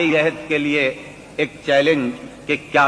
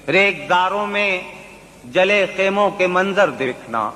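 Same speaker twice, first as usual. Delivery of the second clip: poetry recitation; 9 semitones higher than usual. Acted. Mushaira recitation.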